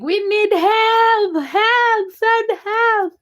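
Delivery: high slow pleading cry for help; voicing screaming legs. Streamer voicing her aching legs, high pleading cry. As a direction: squeal it like aching legs begging. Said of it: "They're like".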